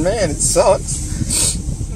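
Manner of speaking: very slow, choked-up words; crying. Real, not acted. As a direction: let the tears slow every word down. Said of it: "I don't mean to be crying".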